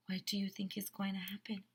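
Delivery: breathless hushed whisper; gasp, then whisper the question to build suspense. Acted. Teacher whispering a suspense question to the children.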